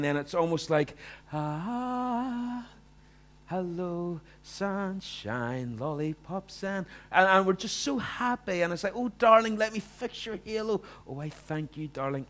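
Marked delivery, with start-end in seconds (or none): 1.3-6.6 fake cheerfulness. Fake happiness arriving at church. 9.0-12.3 sugary fake couple. Couple pretending sweetness at church.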